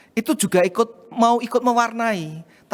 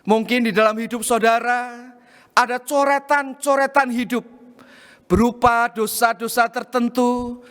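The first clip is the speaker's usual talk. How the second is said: raised, fervent sermon appeal; impassioned preaching; 5 semitones higher than usual. Acted. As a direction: press the lesson home to the congregation. Applies story in a raised preaching voice.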